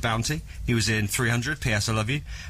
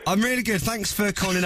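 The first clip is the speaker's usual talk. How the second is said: excited; 6 semitones higher than usual. Real, not acted. Host thrilled by star's call.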